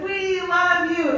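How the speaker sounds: high, drawn-out cheer; band calling back. Retold or imitated. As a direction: stretch each word as a crowd's reply. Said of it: "And they said".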